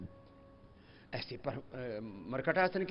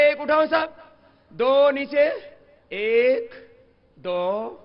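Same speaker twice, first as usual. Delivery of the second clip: counting aloud; 4 semitones higher, 7 dB louder, much slower than usual. Real, not acted. Calls out exercise count to class.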